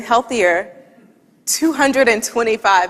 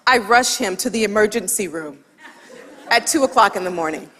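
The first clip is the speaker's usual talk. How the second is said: ordinary delivery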